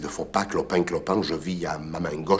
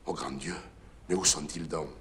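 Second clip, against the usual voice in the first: much softer, low horrified plea; hushed dismay; 3 semitones lower, 11 dB quieter than usual. Acted. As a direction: murmur in aghast disbelief. Curé aghast.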